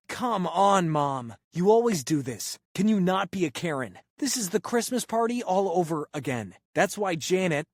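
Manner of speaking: put-on a teen finally losing it at his mother; exploding at mom. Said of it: "son finally has enough and explodes".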